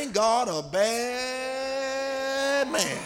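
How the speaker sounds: very slow, stretched out; drawn-out call to neighbor. Acted. Preacher having the church tell their neighbor, stretched slow. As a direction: draw every word out long for the crowd. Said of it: "Touch your neighbor and say".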